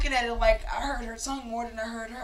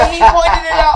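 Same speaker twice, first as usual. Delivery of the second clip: loud, higher exclamation through laughter; laughing outburst; 6 semitones higher, 15 dB louder than usual. Real, not acted. Telling co-hosts he slipped first, loud and laughing. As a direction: crow it loudly over the others.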